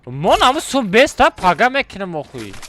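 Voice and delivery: loud, insisting he'll do it tomorrow. Real, not acted. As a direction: raise your voice, fed up. Insisting during argument with friend.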